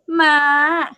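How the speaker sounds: ordinary talk, nothing marked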